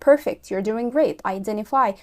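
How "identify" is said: "Identify" as "eye-den-ify"; dropped T. Shown as a demonstration. Teacher answering her own question, dropping the T. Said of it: "هتقال إيه".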